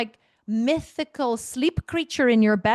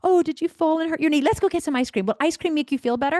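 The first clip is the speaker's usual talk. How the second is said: higher, louder sing-song coaxing; cooing parent; 6 semitones higher, 4 dB louder than usual. Retold or imitated. Parent cooing over a child's hurt knee, higher and louder. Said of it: "we immediately say".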